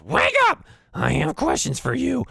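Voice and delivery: high, loud goblin screech; piercing, demanding goblin shout; yelling goblin boss. Acted. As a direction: screech in the prisoner's face. Goblin boss slaps prisoner awake.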